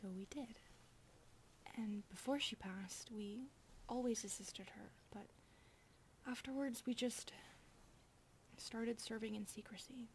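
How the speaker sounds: soft hushed storytelling whisper; whispered story. Acted. Maid telling the master their childhood story, whispered. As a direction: tell the past quietly and tenderly.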